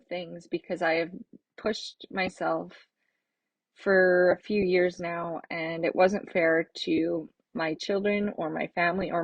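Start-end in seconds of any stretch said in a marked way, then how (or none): none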